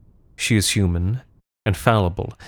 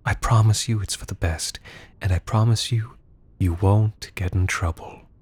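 menacing whisper; 2 semitones higher than usual. Acted. Whispered manipulation in embrace.

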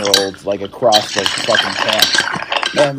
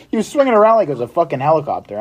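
ordinary talk, nothing marked

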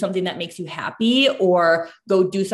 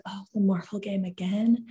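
mock-weary, deflated question; half-hearted groan at a child's request; retold reluctance; 4 dB quieter than usual. Mom doing laundry answers daughter's game request, mock reluctant.

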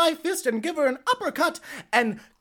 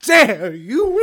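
outraged shout; 5 dB louder than usual. Acted. Outraged ex-wife.

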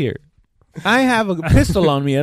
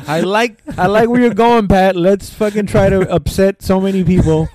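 loud, raised, laughing egging-on; mock enthusiasm; 4 semitones higher, 7 dB louder than usual. Acting out one host egging on Pat, loud mock enthusiasm. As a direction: cheer on a terrible idea through laughter. Mocking their own reckless egging-on.

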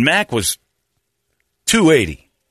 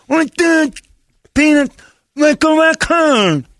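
put-on high, loud, slow kid voice; hammed-up kid; mock kid voice; 5 dB louder, slower than usual. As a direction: squeal it like a baffled kid. Other kid voice high and far.

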